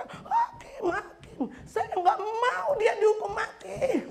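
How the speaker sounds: high, loud, desperate plea with a laugh in it; mother pleads with the judge, half laughing as she cries out; crying out to the judge. Retold or imitated. A retold mother cries out loudly, pleading. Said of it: "dia teriak".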